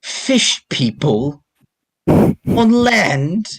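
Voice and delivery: slow, then a high disbelieving rise on land; lightly voiced baffled captive, stretched out; incredulous prisoner.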